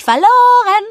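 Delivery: high, loud repeated taunt; gleeful jeering shout; gloating call. A narrator's reading. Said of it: "rief ich".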